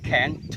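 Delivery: can't ending in a clearly popped T; released final t. Shown as a demonstration. English teacher showing learners the popped T, released crisply in can't. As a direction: pop the final T of can't out loud. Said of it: "dengan cara diletupkan".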